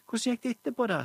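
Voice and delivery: lys, ivrig jentestemme som spør mamma; spør som et nysgjerrig lite barn; ivrig barnestemme. Acted. (Julia spør mamma ivrig, med lys barnestemme)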